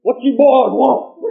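loud, high, slow drawn-out challenge; mimicking angry big man. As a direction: bellow it like the soaked homeowner. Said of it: "got up and said".

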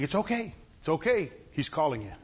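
low, soft reassurance; gently calm someone who is distraught; comforting. Acted. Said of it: "and comforted him".

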